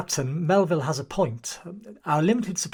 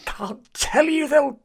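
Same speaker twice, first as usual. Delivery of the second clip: higher, very slow terrified plea; 5 semitones higher, 5 dB louder, slower than usual. Acted. Paranoid seaman refusing the chief, terrified plea. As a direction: hold back the name in terror. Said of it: "No one's going to hurt you".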